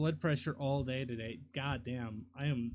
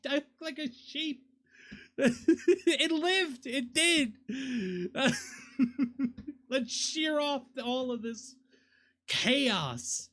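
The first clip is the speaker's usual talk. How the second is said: high, loud voice full of laughter; real laughing talk; 10 semitones higher, 6 dB louder, slower than usual. Real, not acted. Streamer laughing at looking like a sheep, high giggly talk. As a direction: talk through a fit of giggles, pitched well up.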